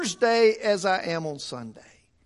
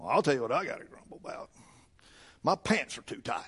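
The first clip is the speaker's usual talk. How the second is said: mock grumble; 8 semitones lower, 9 dB quieter, faster than usual. Preacher mock-grumbles about himself.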